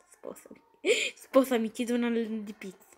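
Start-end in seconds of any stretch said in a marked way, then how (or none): 0.1-0.6 breathy whispered marry me; whispered proposal. Pretend. Gamer jokingly proposing to Chica, in a whisper.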